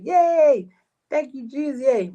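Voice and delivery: high, chirpy mock celebration; mock cheer. An imagined mother's reaction, a high chirpy mock cheer. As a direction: cheer like an imagined happy mom. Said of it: "anybody else would have been like".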